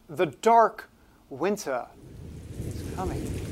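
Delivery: slow, ominous, then a breathy whisper on coming; a mock-sinister Hitchcock drawl fading to a hush; Alfred Hitchcock. Host teases the gloomy winter backdrop, mock Hitchcock. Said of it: "I think this is my Alfred Hitchcock".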